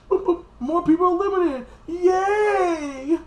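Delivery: high, loud squealing hooray; shrill fake celebration; mock cheer. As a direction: cheer it like a giddy kid.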